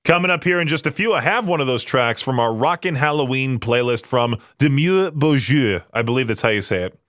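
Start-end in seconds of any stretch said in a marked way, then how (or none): 4.6-5.8 lightly attempted French accent; bad French accent. Shown as a demonstration. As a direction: say the name in your worst French accent. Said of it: "In my worst French accent possible".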